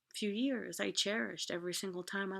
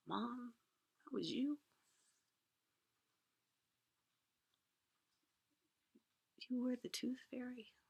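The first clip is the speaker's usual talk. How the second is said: whispered, broken question, higher and much softer; tearful disbelief; 4 semitones higher, 10 dB quieter than usual. Retold or imitated. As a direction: ask through tears, barely audible. Crying child asks mom, betrayed.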